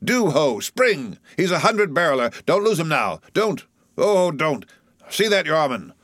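high-pitched, frantic pleading with rowers; excited cry. A narrator's reading. Flask pleads with his lads to row harder, excited cry. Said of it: "cried Flask, dancing up and down".

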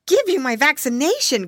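high, loud indignant piglet; Curly crying out. A narrator's reading. Piggy boy answering the snail's demand, high protesting cry. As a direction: cry it out high, as a startled question. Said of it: "cried Curly".

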